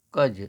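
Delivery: کج said as one short clear syllable; joined syllable announced. Shown as a demonstration. Teacher announcing the joined syllable to the class. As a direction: land the result کج firmly after spelling. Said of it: "کاف اور جیم کو ملا کر کے لکھیں گے".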